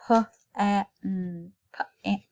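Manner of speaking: "Pa-an" split into onset and stretched vowel; segmented word. Shown as a demonstration. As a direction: sound out "Pa-an" in slow parts.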